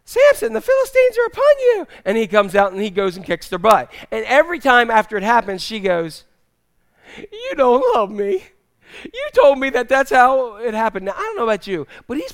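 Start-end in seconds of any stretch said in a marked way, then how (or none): 0.0-1.9 high-pitched, loud yell of alarm; shrill-pitched alarm call. Acted. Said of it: "she yells". 7.2-11.1 mock-hurt girlfriend voice; whiny reproach. Acted reproach from Delilah, high.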